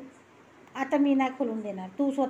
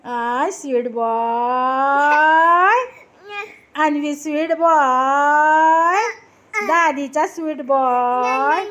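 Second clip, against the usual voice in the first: drawn-out cooing; 5 dB louder, much slower than usual. Real, not acted. Grandmother cooing loudly at her grandson, slow and doting. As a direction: coo each word long and loud, doting.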